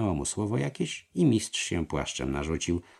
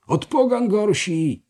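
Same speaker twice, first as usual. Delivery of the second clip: high, loud, drawn-out yell; 7 dB louder, slower than usual. A narrator's reading. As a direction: scream the accusation, slow and high. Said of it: "zawrzeszczał Schwelborn". (przeciągły, wysoki wrzask)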